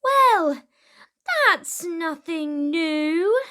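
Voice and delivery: high, loud bored Vikings; chorus of Vikings. Acted. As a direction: shrug it off as a bored gang. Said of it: "the other Vikings said".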